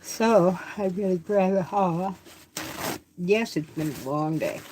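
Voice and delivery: talking through a yawn; yawning while speaking. Real, not acted. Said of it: "I'm yawning".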